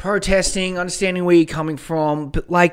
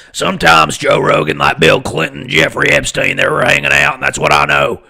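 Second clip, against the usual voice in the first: loud, forceful ranting showman voice; Alex Jones; 6 dB louder than usual. Acted. Podcaster claims he can go forever, loud ranting impression. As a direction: rant at full volume like a radio host. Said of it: "I'm like Alex Jones as well".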